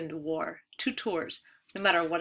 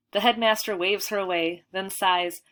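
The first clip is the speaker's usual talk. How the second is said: usual speaking voice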